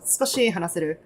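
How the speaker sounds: usual speaking voice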